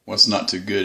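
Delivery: usual speaking voice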